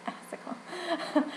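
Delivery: giggled out, soft and drawn-out; slow, breathy laugh through the word. Real, not acted. Patient repeating her new medication's name, laughing.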